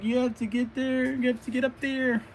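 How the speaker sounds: high-pitched acted urging; urgent urging. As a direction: urge them upward in a high character voice. Acts urging climb to captive.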